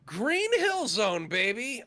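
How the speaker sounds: slow, hyped reveal; dramatic reveal. Pretend. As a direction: drag it out for drama. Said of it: "pause for dramatic effect".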